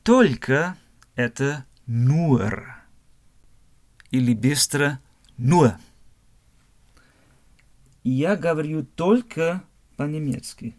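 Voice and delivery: normal talking voice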